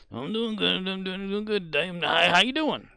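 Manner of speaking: high, sing-song greeting from Dave; hammed-up repeated greeting; mimicking Dave. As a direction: parrot it like a broken record, high.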